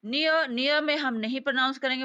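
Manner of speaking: normal talking voice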